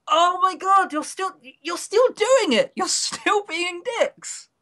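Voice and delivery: high-pitched disbelieving rant, slowing at the end; incredulous high exasperation; exasperated. Real, not acted. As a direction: squeal it out in disbelief, then drag the last line.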